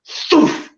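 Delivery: airy voiceless whoosh; quick rush of air through the lips; imitated whoosh. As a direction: make the noise of something flying off. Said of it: "It went".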